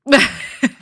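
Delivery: quick amused chuckle; short laugh. Real, not acted. Laughs at own joke.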